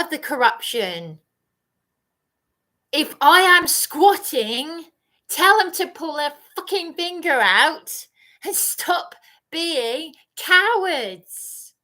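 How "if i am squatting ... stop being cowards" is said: loud, higher, slow, dragged-out "cowards"; angry rant. Real, not acted. Raging that police should stop being cowards, loud and slow. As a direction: spit the contempt at them.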